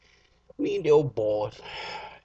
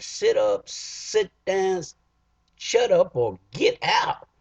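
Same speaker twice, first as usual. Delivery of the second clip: louder, rising to a high snap; barked order; 9 semitones higher, 5 dB louder, slower than usual. Retold or imitated. Man at front of the AA meeting yelling at newcomer, louder. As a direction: bark it like a fed-up doorman.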